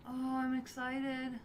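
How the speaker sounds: normal talking voice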